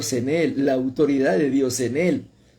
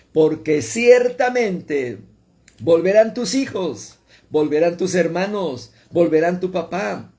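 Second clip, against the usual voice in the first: loud, slow, rising preacher's declaration; preaching at full force; 3 semitones higher, 3 dB louder than usual. Real, not acted. Preacher proclaiming to the congregation that the lost will return, fervent and loud. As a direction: proclaim it to the whole church.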